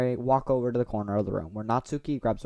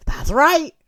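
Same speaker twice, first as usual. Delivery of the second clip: laughing, higher, louder blurted take; the wrong take, laughed and pitched up; wrong line read; 11 dB louder than usual. Acted. Player misreads Natsuki's line, laughing and loud. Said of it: "Wait, no, I said that wrong".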